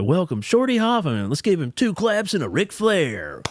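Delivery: high, slow hype announcer voice; mock ring announcer welcoming the guest; announcer intro. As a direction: introduce the guest like a wrestling show host. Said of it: "every day they said".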